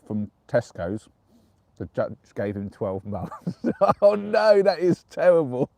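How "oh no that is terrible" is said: high, loud, amused dismay; laughing groan. Real, not acted. Streamer reacts to a terrible calendar joke, laughing dismay. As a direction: cry out through laughter at an awful joke.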